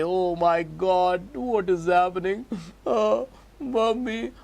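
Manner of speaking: put-on high, slow, childish wail for mommy; a molecule crying out to its mommy, drawn out; whining oxygen. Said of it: "Now this oxygen will say".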